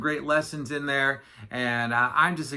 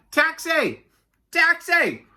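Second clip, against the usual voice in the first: high, hailing shout; imitated shouting Camel; 13 semitones higher, 5 dB louder, much slower than usual. Yak imitating Camel hailing him, high shout. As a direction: call out for a cab in a high voice. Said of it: "standing there shouting".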